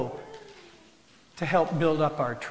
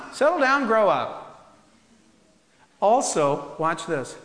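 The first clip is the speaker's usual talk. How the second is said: normal talking voice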